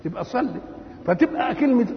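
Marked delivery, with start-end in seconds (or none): none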